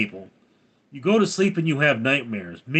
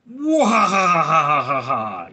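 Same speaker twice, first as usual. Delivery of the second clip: big, loud, drawn-out hammed-up cackle; mock laugh; 6 dB louder, slower than usual. Streamer reading a viewer's typed laugh aloud, loud mock laugh. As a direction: belt out a long fake "wahaha".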